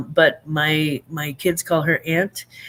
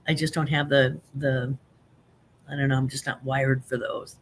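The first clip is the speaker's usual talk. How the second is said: ordinary delivery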